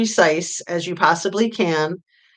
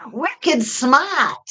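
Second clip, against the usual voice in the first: put-on playful, high-pitched Maine accent; 8 semitones higher, 3 dB louder than usual. Reading back a chat member's joke, Maine accent. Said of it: "smart jazz, Maine accent".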